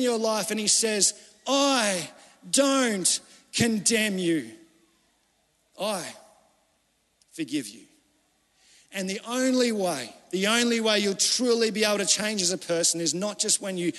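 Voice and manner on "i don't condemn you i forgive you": tender forgiving voice. Pretend. God forgiving the sinner, slow and tender.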